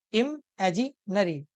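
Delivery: "इमेजिनरी" very slowly, in three chunks; split into syllables. Shown as a demonstration. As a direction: say "इमेजिनरी" as im... aji... nary. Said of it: "तीन हिस्सों में टूट रहा है". ("इमेजिनरी" को तीन टुकड़ों में, धीरे)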